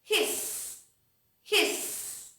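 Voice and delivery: put-on teacher repeats the hiss for the children; snake hiss. Shown as a demonstration. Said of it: "What is the sound of this snake?".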